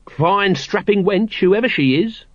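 slow, high-pitched approval; approving. A narrator's reading. Nobby eyeing the woman below, approving. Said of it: "said Nobby approvingly".

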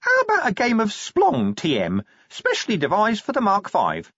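begging to be kept on; pleading imp. Acted. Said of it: "pleaded the imp".

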